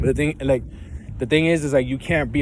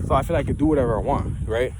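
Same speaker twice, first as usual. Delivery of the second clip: ordinary delivery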